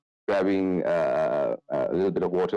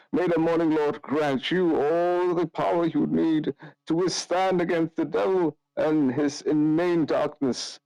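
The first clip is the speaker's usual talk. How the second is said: higher-pitched priest, slowing at the end; priest's blessing; 10 semitones higher than usual. Acted. Father Lucian blesses the party's weapons, high intoning priest voice. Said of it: "and just goes".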